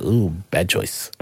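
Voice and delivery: low, slow, quiet warning; ominous tease. Retold or imitated. To his son ordering the sundae, a slow ominous tease. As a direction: drag it out like a knowing threat.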